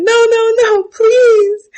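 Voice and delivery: high, loud hammed-up begging; tortured man begging the crab feeder, hammed up high; mock screaming plea. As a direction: scream no please in a high put-on voice. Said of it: "while the man is screaming".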